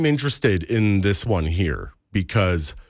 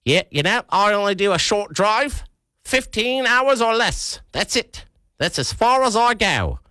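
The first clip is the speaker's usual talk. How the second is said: put-on high, sing-song New Zealand accent; breezy New Zealander, pitched way up; 12 semitones higher than usual. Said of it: "I don't know why you have a New Zealand accent".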